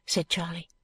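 ordinary delivery, nothing marked

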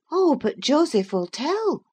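put-on high-pitched girl's character voice; young Catherine. A narrator's reading. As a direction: pitch it well up for Catherine questioning Heathcliff. Catherine warns Heathcliff.

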